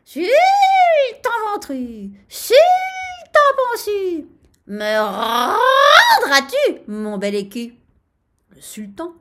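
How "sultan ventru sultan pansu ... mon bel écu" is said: loud crow with stretched vowels; crying out like a rooster. A narrator's reading. Rooster crows in the sultan's ear, crying out. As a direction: crow the taunt insistently. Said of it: "il cria".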